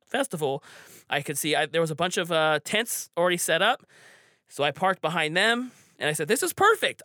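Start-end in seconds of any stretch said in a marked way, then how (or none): 6.3-7.0 high, pleased quote of himself; delighted exclamation. Retold or imitated. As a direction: pitch up on perfect, pleased. Teller voices his delight on arrival.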